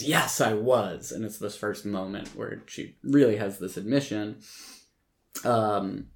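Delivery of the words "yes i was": ordinary talk, nothing marked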